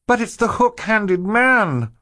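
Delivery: high, slow desperate shout. A narrator's reading. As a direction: plead at full volume. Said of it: "Violet shouted".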